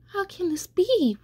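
high-pitched, anguished question; distressed disbelief. A narrator's reading. Rex cries out in disbelief.

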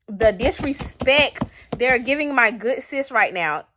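loud, high indignant outburst; distressed outrage. Real, not acted. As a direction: vent at the characters' disrespect. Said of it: "I am so much distress right now".

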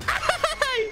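high shrieking with laughter; startled shriek. Real, not acted. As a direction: scream at a false scare. Jumpscare with nobody there.

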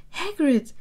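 high, loud cry of surprise; surprised yell. A narrator's reading. Two teenagers spot their old friend Hagrid, surprised yell. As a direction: call out his name in surprise. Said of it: "brüllten die zwei Teenager überrascht".

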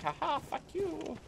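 high, mock-villain jeer in another voice; mocking taunt. Player imitating the enemy who locked him out, mock gloating taunt. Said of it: "that guy was gonna ... be like".

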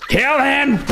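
high, strained yell; frantic anger. Real, not acted. Gamer ordering an attacker killed, frantic anger. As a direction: screech the order in frustration.

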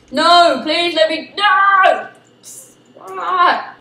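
loud, high begging no; pleading cry. Real, not acted. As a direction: plead loudly. Begging in game.

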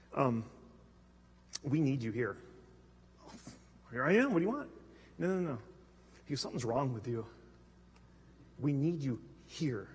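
put-on hushed worried teen. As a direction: lean in and quietly insist. Worried teen confronting the pastor.

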